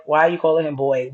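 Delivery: ordinary talk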